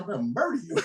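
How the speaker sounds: playful outrage at the judge; mock threat.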